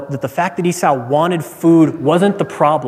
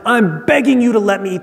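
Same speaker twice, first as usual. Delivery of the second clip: higher-pitched acted plea; begging; 6 semitones higher than usual. Esau asking Jacob to eat, begging. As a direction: plead with a touch of urgency. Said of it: "the picture is not just".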